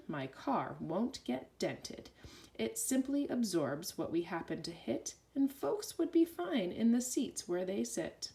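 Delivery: usual speaking voice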